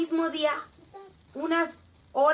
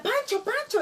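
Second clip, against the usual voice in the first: urgent calling out; 10 semitones higher than usual. Retold or imitated. Father calls friend frozen by fear.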